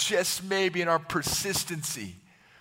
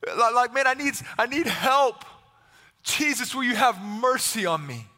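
higher, urgent appeal; acted desperation, voice raised; desperate plea; 4 semitones higher than usual. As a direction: plead for someone to help. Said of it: "in this place of desperation".

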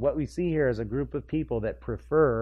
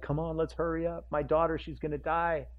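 urgent Jairus; 4 semitones higher, 6 dB quieter than usual. Retold or imitated. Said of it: "Jairus is like".

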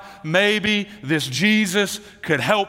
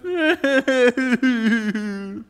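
high, long fake wail; exaggerated sobbing noise trailing downward; mock crying; 6 semitones higher than usual. Shown as a demonstration. Preacher shows kids what crying makes them think of, mock wail. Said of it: "I think of".

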